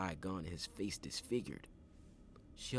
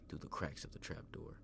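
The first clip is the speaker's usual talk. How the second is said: ordinary delivery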